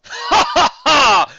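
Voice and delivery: big loud high laugh; Gideon's hearty laugh at the council; hearty booming laugh. A narrator's reading. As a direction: throw back your head and roar with laughter. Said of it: "Gideon chuckled".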